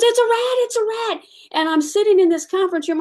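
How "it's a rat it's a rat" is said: shrill panicked cry, very high and loud; screaming receptionist. Retold or imitated. Receptionist shrieking at a rat on her desk. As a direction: squeal it like she just saw a rat.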